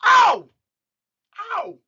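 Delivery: loud high yelp of pain. Shown as a demonstration. Teacher acting out a stepped-on foot with a loud, high yelp. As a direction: cry out as if someone stood on your foot. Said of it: "what you say when someone stands on your foot".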